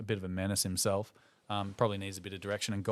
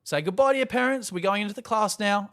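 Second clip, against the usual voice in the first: high, loud teacher call; imitating a brisk teacher; 10 semitones higher, 7 dB louder than usual. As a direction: call the class inside. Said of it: "the teacher goes".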